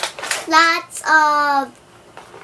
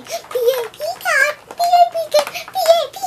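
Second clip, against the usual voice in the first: high, louder mock-repeat of mom's correction; mocking mommy; 11 semitones higher, 3 dB louder, much faster than usual. Girl teasingly imitates mom correcting her word, high and mocking. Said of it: "sorry about my mommy saying".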